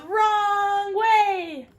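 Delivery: high, loud warning call; sign's warning voice. Acted. Sign read in character voice.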